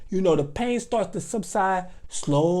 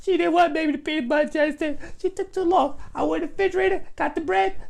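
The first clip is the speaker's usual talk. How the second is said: put-on high, fast child voice telling on sister; high child snitching on his sister; tattling little kid; 7 semitones higher than usual. Boy snitching to mother.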